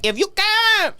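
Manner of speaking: high-pitched broken-English accent, imitating dad; dad hamming up a foreign accent; dad's mock accent. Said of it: "left words out and did the accent".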